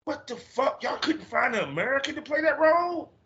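high-pitched, comic indignant rant; retold outrage. Retold outrage at English casting.

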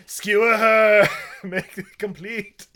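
loud, slow laughing cheer. Retold or imitated. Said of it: "And I'm like".

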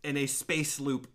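usual speaking voice

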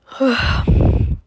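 heavy loud sigh. A narrator's reading. Narrator sighs over the unhappy ending, heavy and loud. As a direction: let out the sorrow.

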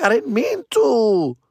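high, slow mock whine; hammed-up pleading, pitched high; whiny excuse. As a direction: plead innocence in a drawn-out whine.

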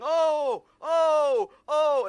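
loud high mock cheering; hammed-up excited oh's; excited crowd. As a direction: imitate the gang whooping. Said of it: "everyone's going".

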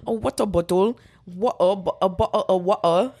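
laughing attempt at British vowels; hammed-up British, tripping over the sounds; British accent. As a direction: stumble through a fake British accent. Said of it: "nope, British".

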